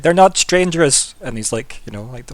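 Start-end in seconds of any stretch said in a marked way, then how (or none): 0.0-1.1 put-on child's protest. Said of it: "Jet in the background".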